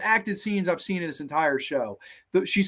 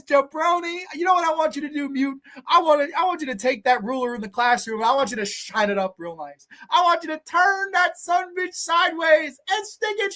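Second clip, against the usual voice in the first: wrestling promo rant; 8 semitones higher than usual. Acted. Taunting the mute girl, wrestling promo style. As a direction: cut a promo like a pro wrestler.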